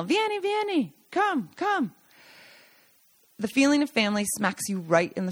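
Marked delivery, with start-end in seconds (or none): 0.0-1.9 much higher, called-out come-in; high sing-song call of welcome; calling from inside. Retold or imitated. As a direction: shout them in from another room. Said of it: "someone yell".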